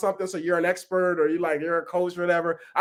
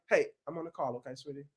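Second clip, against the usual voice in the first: softer, quick word to her daughter off mic; hushed aside; 6 semitones lower, 12 dB quieter, faster than usual. Real, not acted. Speaker hushing her daughter during a call, softer aside.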